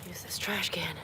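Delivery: soft whisper. Real, not acted. Unvoiced whisper.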